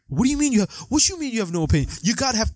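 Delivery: high, rapid disbelieving outburst; incredulous demand. Retold or imitated. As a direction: fire the questions off in shocked disbelief. Jules demanding Marvin's opinion.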